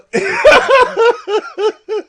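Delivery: loud, high laughter; cracking up. Real, not acted.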